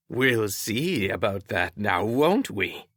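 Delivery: slow, understated sneer; slow veiled challenge. A narrator's reading. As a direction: drag it out with a mild sneer.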